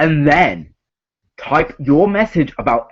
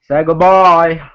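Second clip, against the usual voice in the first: louder, slower, drawn-out farewell; dramatic announcement; 2 semitones higher, 4 dB louder than usual. Acted. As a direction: announce it like a game show host. Dramatic repeat of the elimination.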